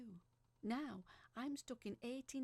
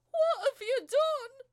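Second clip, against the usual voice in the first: much higher, louder, slow demand; furious shout; 18 dB louder, slower than usual. Acted. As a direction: yell at him in shock. Burned hand, confronting John.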